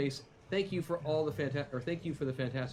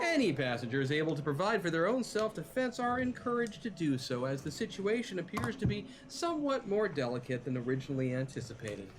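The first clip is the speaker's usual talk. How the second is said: PA announcer; 3 semitones higher than usual. Acted. Railway announcer addressing endangered passengers, formal announcement. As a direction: break bad news in polite officialese. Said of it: "another hail over the P A system".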